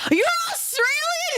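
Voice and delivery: sudden high double-take; surprised. Real, not acted. Streamer reacting to a viewer being Australian, high surprise. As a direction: pitch jumps way up in mock shock.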